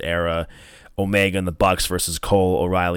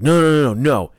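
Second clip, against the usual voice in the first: higher, louder rapid string of noes; retold protest; 6 semitones higher, 4 dB louder than usual. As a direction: cut in, imitating an insistent boss. Retold villain ordering more.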